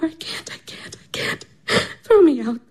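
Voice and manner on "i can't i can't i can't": broken whisper, almost no voice; choked tearful whisper; whispered sobbing. Acted. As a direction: sob the words out under your breath. Irina sobbing, breath failing.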